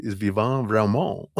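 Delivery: mock French accent, hammed-up; fake French accent. Shown as a demonstration. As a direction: play a badly faked French accent. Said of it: "My fake, horrible French accent".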